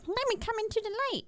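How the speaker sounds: tiny high squeak of a bug begging for light; squeaky bug voice. Pretend. Friend jokingly voicing a cockroach, high squeak. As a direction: voice the bug as a silly little creature.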